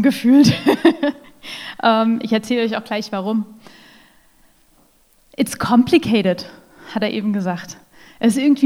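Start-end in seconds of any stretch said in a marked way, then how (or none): none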